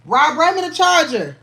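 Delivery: loud high call across the house. Real, not acted. Calling to Rob in another room, loud and high. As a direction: shout it to the next room.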